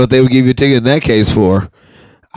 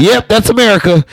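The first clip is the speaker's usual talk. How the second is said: higher, amused retort; mock reply to a friend; 7 semitones higher than usual. Telling a friend off with a laughing high voice. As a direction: tease him in a raised voice. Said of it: "I was like".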